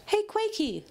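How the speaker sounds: high, bright call; cheeky teasing. A narrator's reading. Pinky teases Quake from her parachute, high call.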